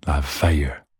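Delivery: drawled southern US "La fair"; a light southern US twang; southern US accent. Shown as a demonstration. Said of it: "in a southern U S accent".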